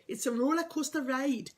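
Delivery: a quick, half-hearted Geordie impression; Ant and Dec accent. Quoting the TV catchphrase to viewers in a bad Geordie accent. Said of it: "That's a bit of a rubbish accent".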